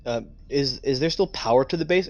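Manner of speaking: ordinary talk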